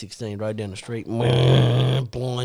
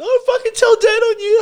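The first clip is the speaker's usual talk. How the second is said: put-on tattling kid; 4 dB louder than usual. Little brother threatens to tell.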